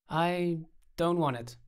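slow, effortful, holding the voice steady; forced steadiness. A narrator's reading. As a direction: fight to keep it steady. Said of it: "Effort to keep my voice steady".

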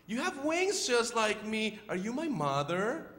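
high little-bird voice; Choco. Acted. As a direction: plead hopefully as a lonely chick. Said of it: "How about you be the voice of Choco?".

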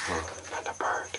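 quick hushed whisper. Real, not acted. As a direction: whisper it so the bird stays. Spotting a bird quietly.

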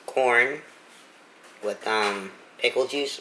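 chewing between words; talking over food; mouth partly full. Real, not acted. Seen 2: holds taco, lips pursed and working between words, bite earlier.

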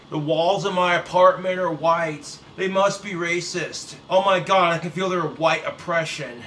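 ordinary talk, nothing marked

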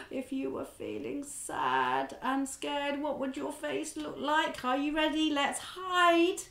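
usual speaking voice

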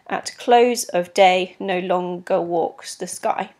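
slow, measured beat stressing "close", "day", "long", "walks", "sky"; deliberate da-DUM rhythm on "At close of day, no longer walks the sky"; iambic line reading. Shown as a demonstration. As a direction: pace it out slowly, weight on every second syllable from "close" to "sky". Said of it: "you'll see what I mean".

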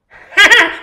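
high, loud cartoon giggle; hammed-up squeaky laugh; SpongeBob cackle.

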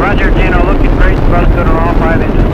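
loud, high, thrilled shout; excited. Real, not acted. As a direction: cheer the rocket on over the roar. Launch commentary at liftoff.

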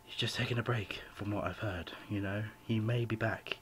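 usual speaking voice

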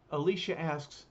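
plain reading voice

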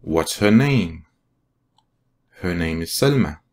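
slow, clear model sentences for repeating; slow, carefully spaced teacher read-aloud. Shown as a demonstration. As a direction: read each line slowly so learners can repeat it. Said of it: "لنكرر".

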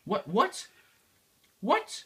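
stunned, climbing to a yelp; rising disbelief. Real, not acted. Hits the edge of world.

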